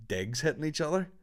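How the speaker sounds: ordinary delivery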